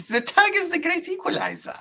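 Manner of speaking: put-on high German-accented proclamation. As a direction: announce it like a German salesman. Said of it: "He loves your German accent".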